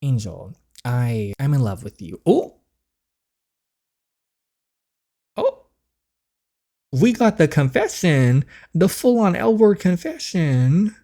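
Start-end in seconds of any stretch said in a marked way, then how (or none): 2.2-5.7 high, loud delighted "Oh!"; surprised gasp. Real, not acted. Reacting to love confession. 6.9-11.0 higher, louder and slow, savoring it; thrilled. Real, not acted. Thrilled reaction to confession.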